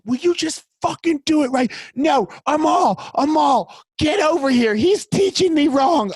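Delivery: high-pitched exasperated mock yell; worked-up, high-pitched yelling in imitation; retold screaming. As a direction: re-enact a screaming argument, voice shooting way up. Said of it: "screaming at me and me going".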